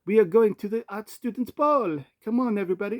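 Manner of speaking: high-pitched mock French accent; French art student, pitched high. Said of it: "it's gotta be a French accent".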